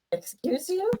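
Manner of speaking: high, offended "excuse you"; indignant. Acted. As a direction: snap back offended.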